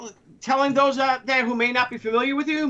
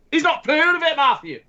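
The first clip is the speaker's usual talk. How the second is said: higher, hammed-up Scottish brogue; mock indignation in a put-on Scottish accent; 6 semitones higher than usual. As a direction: crow it out in broad mock Scottish. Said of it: "I wish I was a Scot".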